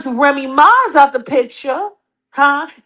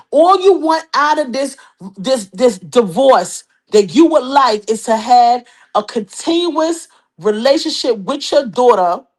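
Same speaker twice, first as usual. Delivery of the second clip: ordinary talk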